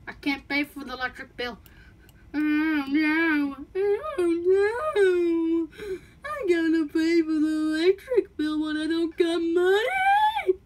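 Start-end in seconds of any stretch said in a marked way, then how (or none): 2.3-10.6 wailing. Acted. Panic over unpaid electric bill.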